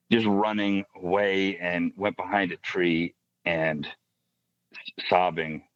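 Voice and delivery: normal talking voice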